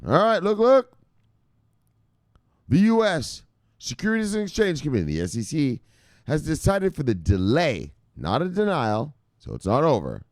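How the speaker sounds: rowdy, high-pitched start easing off; a little rowdy. Real, not acted. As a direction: burst in rowdy, then settle as you read. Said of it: "I got a little rowdy there".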